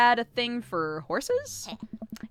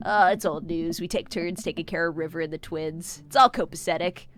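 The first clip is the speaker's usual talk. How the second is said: ordinary talk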